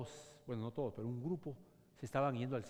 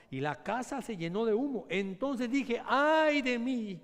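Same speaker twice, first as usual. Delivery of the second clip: louder and higher, ending in a long drawn cry; rising cry; 8 semitones higher, 8 dB louder, slower than usual. Acted. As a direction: build up to a wailing 'Ay de mí'. Climactic reading of Isaiah's cry.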